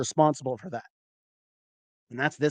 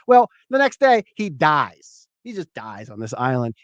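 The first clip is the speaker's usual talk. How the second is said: usual speaking voice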